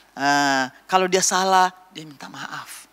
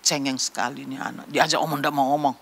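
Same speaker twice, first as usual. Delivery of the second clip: lower, mildly disapproving mutter; grumbling adult; 5 semitones lower, faster than usual. Acted. Adult complaining about an unresponsive child, low mild grumble.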